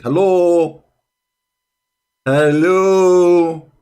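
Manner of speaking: loud, high, mocking call of hello; exasperated hello. Real, not acted. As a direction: shout it as if nobody's home. Mocking opponent's claim, calling out.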